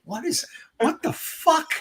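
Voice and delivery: high-pitched, baffled replay of the moment; mock disbelief, voice jumping up; retold bewilderment. Dazed after the police pulled him out, baffled and high.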